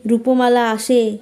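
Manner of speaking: usual speaking voice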